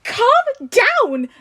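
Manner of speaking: high, loud shrieked echo; aunt shrieking back at Tony, high and loud. A narrator's reading. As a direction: throw the words back in a shriek. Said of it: "May shrieked".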